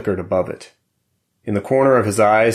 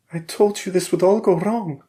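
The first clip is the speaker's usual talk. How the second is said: higher, reproachful wife; Valeria's voice; 11 semitones higher than usual. A narrator's reading. Wife reproaches her husband, higher. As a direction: remind him she was right.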